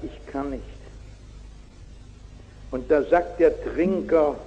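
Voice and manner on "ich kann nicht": put-on very soft, lower voice of a weeping boy; softly imitating a crying child. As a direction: let it come out small and tearful. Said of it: "der Junge weint und sagt".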